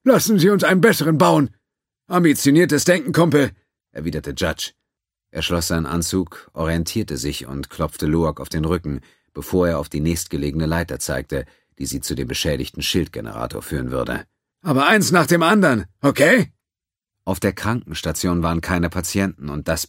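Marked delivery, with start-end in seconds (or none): 0.0-3.5 high, loud voiced crewman; character voice. Judge answers his crewmate. 14.6-16.5 high, loud voiced crewman; character voice. Judge talks to Loak.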